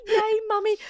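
put-on high toddler voice cheering for mummy; sleepy toddler. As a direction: squeal it like a two-year-old just woken. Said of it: "She was like".

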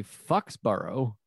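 Foxborough with "fucks" as first syllable; mispronounced Foxborough. Retold or imitated. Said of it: "to slip in fuck".

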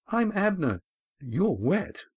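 put-on a boy named Abner blurting; young boy. A narrator's reading. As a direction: state it plainly like a small child. A boy introduces himself.

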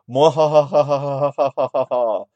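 slow, loud villain laugh; evil cackle. Acted. Evil laugh after monologue.

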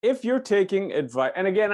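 usual speaking voice

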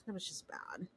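hushed, breathy dismay; whisper. Real, not acted. Whispered to her cohost about the bleeding eye, dismayed. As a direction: whisper it like it's too awful to say.

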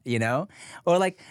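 ordinary talk

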